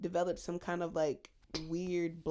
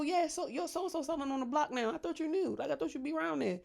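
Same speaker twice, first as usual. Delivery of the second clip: higher, sly messy gossip; 7 semitones higher than usual. Retold or imitated. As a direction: drop the news like a messy friend. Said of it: "Like, you know".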